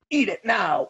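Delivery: high, hammed-up order; mock command. Acting out force-feeding the party guests.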